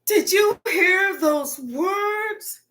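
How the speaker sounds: high, slow scandalized gasp and whine; hammed-up gasp of offended shock; mock shocked gasp. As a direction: play a horrified prude. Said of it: "I'm not gonna go".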